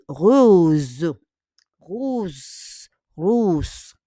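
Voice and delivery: rose as "rooz", drawn out with buzzing end; sounded feminine ending. Shown as a demonstration. Teacher models the feminine, rose with sustained ending. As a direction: repeat rose slowly, holding the final consonant. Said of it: "we need to add S".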